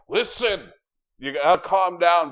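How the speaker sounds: high, loud mock plea from the bear; hammed-up bear begging for calm; bear character pleading. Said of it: "the bear's like".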